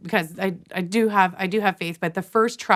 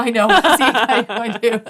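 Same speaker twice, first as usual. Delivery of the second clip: laughing; 5 semitones higher, 9 dB louder than usual. Real, not acted. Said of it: "Oh, don't".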